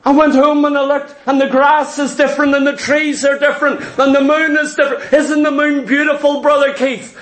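imitated wonder, lifted voice; lightly acted new convert marvelling; Jeffrey. New convert telling his pastor of changed world, mock amazement. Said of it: "when Jeffrey come in that first time, he says".

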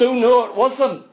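ordinary talk

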